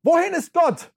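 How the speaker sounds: high, loud anguished cry. Acted. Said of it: "rief er". (lauter, hoher Ausruf)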